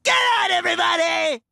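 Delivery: high shouted order. Acted. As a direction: yell it out to the whole room. Quagmire shouts at the bar.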